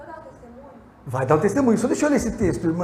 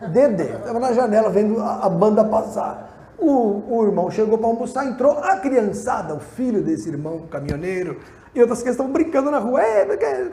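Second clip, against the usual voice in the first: loud, driving pulpit storytelling; preaching story; 4 semitones higher, 6 dB louder than usual. Acted. Pastor tells the congregation about the runaway truck, in loud preaching. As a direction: build the drama of the runaway truck for the church.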